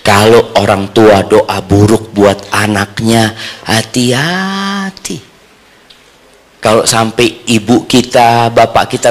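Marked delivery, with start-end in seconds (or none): 3.7-5.3 high, softer, long-stretched caution; drawn-out warning. Real, not acted. Preacher warns listeners about parents' bad prayers, drawn-out warning.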